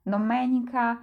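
domenica said do-MEH-ni-ka, second syllable stressed; stress third from end. Shown as a demonstration. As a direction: show where the stress falls in domenica. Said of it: "akcent na trzeciej sylabie od końca".

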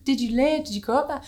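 high, eager questions; a receptionist gushing over a hometown, high and eager; enthusiastic. Retold or imitated. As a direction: gush with curiosity about their hometown. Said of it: "so enthusiastic".

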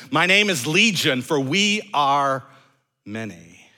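loud, emphatic retold demon, dropping at the end; demon's reply. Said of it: "the demon replies to Jesus' question".

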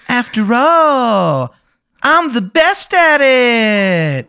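high, loud, drawn-out singer impression; bad, exaggerated folk-singer imitation. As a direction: stretch each phrase into a long falling wail. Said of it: "not a very good [name] impression".

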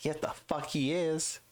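high, drawn-out mock-indignant comeback; defiant retort. Fan defending Dane Cook to doubters, defiant. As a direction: push it up high and stretch it out in protest. Defending comedian against sneering friends.

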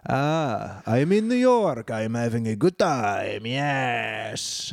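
put-on slow, drawn-out French accent; hammed-up Frenchman gloating on holiday; mock French accent. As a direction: stretch it out in a silly French accent. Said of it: "that was my French accent".

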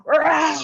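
loud, high demo roar like a tiger. Shown as a demonstration. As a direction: let out a playful tiger rah. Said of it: "just go".

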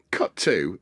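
ordinary delivery

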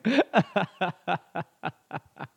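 long, rattling laugh. Real, not acted. Comedian laughing at the heckler's suggestion. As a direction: burst out laughing and keep going.

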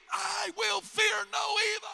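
high, sustained cry; climactic preaching shout. Real, not acted. Preacher defying fear before the church, climactic shout. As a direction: belt the psalm line out high and held.

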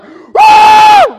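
a huge high whooping yell; gym bro shout. Retold or imitated. Gym guy yelling while lifting, a huge high whoop. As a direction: let out a wild high gym whoop mid-lift. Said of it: "the guys at the gym who are like".

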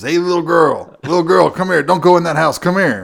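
loud, high mock yelling after someone; imitated screaming. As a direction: holler it as the man in the street. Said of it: "he starts screaming".

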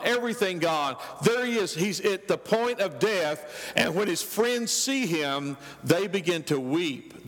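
usual speaking voice

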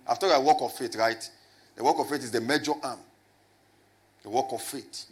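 ordinary delivery

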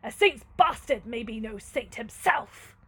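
loud, unyielding lord; stern. Acted. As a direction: lay it down sternly and forcefully. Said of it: "said Manfred sternly".

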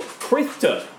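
high pointed name call; smug official calling her out; Brendan. Acted. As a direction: say the name like an accusation. Brendan addresses Gwendolyn sharply.

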